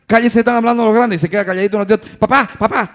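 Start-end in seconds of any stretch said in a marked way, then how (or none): 0.1-1.2 acted loud, higher reprimand; exasperated parent voice, raised; scolding parent. Parent silencing child, acted. 2.2-2.9 higher, louder imitated kid calling dad; insistent mock child call; pestering child. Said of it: "otra vez".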